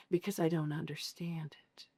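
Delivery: very soft, near-whispered retold confession; hushed admission. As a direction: say it almost voicelessly, as a quiet admission. Retelling husband's confused admission.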